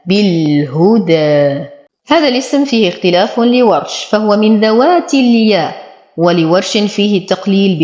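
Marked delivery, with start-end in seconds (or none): 0.1-1.7 بالهدى with a clear damma on the ه; slow tajweed demo. Shown as a demonstration. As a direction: recite بالهدى slowly. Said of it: "وتم ضمة الهاء". (تلاوة بطيئة مع ضمة الهاء)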